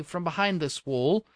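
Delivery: ordinary delivery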